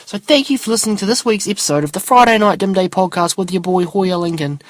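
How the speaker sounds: high-pitched, run-on outro voice; podcast sign-off patter. Acted. Host asking listeners for comments and guests, high-pitched sign-off voice.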